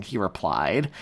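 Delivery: ordinary delivery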